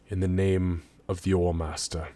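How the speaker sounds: low, soft voice with heavy pauses; grave, reluctant lieutenant. Acted. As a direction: make the arrest quietly and reluctantly. Game master voices the lieutenant.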